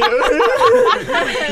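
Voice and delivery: risada alta e aguda; gargalhada aguda. Real, not acted. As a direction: solta a gargalhada. (gargalhada aguda e forte)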